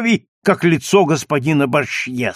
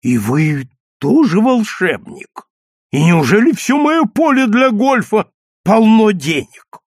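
astonished; 7 semitones higher, 5 dB louder than usual. Acted. Astonished golfer at found money.

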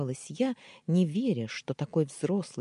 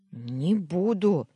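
slow, drawn-out defiant hiss; hissed refusal; 4 semitones higher, 5 dB louder, much slower than usual. A narrator's reading. Heroine backing away from Alisher, slow hissed refusal. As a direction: drag the refusal out through your teeth. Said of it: "зашипела я".

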